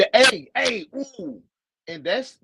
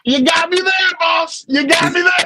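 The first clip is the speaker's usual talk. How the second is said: high, loud laughing admission; laughing concession; 12 semitones higher, 6 dB louder than usual. Real, not acted. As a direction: concede it while cracking up. Caught out by friend's remark.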